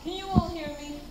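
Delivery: distant voice calling from away from the mic. Real, not acted. Carol asking the audience if they can hear her, off mic. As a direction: check the room can hear you unamplified. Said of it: "I don't think I need a mic".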